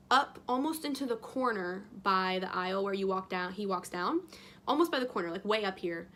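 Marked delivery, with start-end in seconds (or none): none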